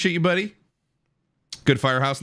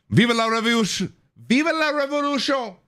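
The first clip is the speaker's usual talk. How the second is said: mock Spanish rallying cry; 12 semitones higher than usual. Streamer playing at revolution, high mock Spanish cry.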